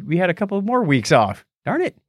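normal talking voice

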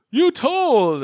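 high-pitched, hammed-up accusation; imitating an indignant outburst; mock pissed off. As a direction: play betrayed outrage, voice jumping up. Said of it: "he's all just absolutely pissed off".

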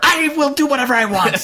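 high, defiant mock yell; hammed-up yelling. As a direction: rant like an unhinged tyrant. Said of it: "every time he yells".

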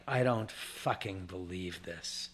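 low, slow, under-the-breath exasperation; muted disbelief. Retold or imitated. Richard seeing the page cry, muted disbelief. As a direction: say it low and slowly, appalled.

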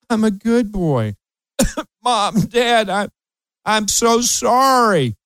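put-on high, slow pleading boy; mock-pitiful teenage plea. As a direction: beg mom and dad like a sorry kid. Teen begging parents to end grounding.

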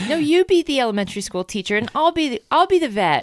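high, insistent child playing pretend; whiny eight-year-old claiming the vet role; little girl voice. Acting kids' pretend play.

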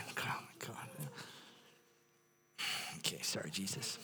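very soft, voiceless aside; whispered aside. Real, not acted. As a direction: whisper it under your breath to yourself. Muttered aside to self mid-story.

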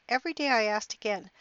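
normal talking voice

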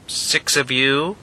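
slow robotic cow statue voice; talking cow. Acted. Talking cow statue counting the group, slow and mechanical. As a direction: count them slowly like a machine. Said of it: "said the cow".

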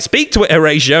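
a hint of a nervous guard; urging guard. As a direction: nudge Horatio to talk to the ghost. Guard voiced in Hamlet retelling.

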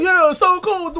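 high excited cheer. Retold or imitated. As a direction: cheer like a fan. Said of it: "They was like".

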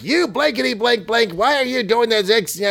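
high, blustering boss voice; blustery editor. Acted. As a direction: rant like a cartoon newspaper boss. Jonah rants at Spider-Man.

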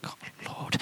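hushed unvoiced whisper to God; whispered plea. Dad at the toilet door pleading with God, whispering. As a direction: breathe it out desperately.

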